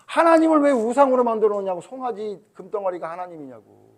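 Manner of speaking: high, loud outraged question; indignant. Real, not acted. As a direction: protest it, outraged. (높고 크게 분개하며)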